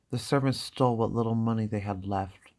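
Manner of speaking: ordinary delivery, nothing marked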